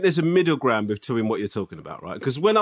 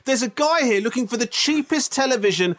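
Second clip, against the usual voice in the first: mock shop announcement; 8 semitones higher than usual. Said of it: "why am I expecting this guy to fucking fall?".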